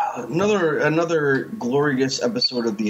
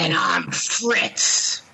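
drawn-out, higher character voice; Fritz; 5 semitones higher, much slower than usual. Acted. Introducing himself as his Halloween character, drawn-out put-on voice. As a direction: stretch it out in a put-on voice. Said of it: "I will be playing the part of Fritz".

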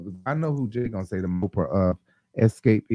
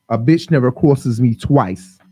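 put-on Lily's accent; 8 dB louder than usual. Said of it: "It just sound like".

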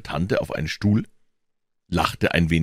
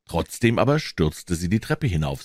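plain reading voice

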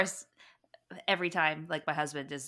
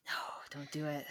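whispered no; 2 semitones lower, 6 dB quieter than usual. Real, not acted. Friend whispers to podcast hosts, pleading no. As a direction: whisper it like a secret plea.

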